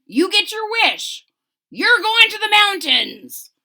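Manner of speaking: eager character calling out; excited, loud and high. Acted.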